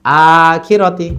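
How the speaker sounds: long আ flowing into quick খিরতি; stretched, joined up. Shown as a demonstration. Teacher reading the whole word together, long আ then quick খিরতি. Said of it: "একসাথে".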